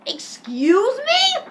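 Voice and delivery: high, loud, long-drawn question; mock outrage. Offended teacher challenges Eric, high drawn-out mock outrage. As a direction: stretch it out in hammed-up indignation.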